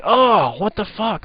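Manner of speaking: high shrieked disgust, mostly pitch; mock scream. Fiance pulling back covers onto fart stench, high mock scream. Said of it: "she just screamed".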